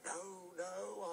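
high, wailing refusal; drawn-out frightened no; mock fright. A narrator's reading. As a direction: refuse in a high, play-scared voice. Toby acts frightened of the ghost.

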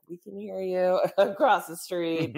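quoted neighbor, amused; laughing call. Retold or imitated. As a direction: relay the shout through a giggle. Neighbor quoted shouting across the street.

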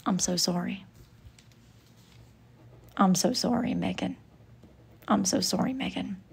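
soft apology. Acted.

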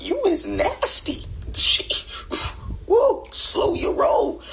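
very high, slow squeaky voice; high squeal. Retold or imitated. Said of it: "I'm like".

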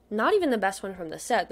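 ordinary talk, nothing marked

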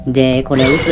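ordinary talk, nothing marked

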